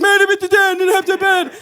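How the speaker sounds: exaggerated fake deaf plea; mock deaf voice. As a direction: plead in put-on deaf slurring. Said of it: "start voicing my concerns".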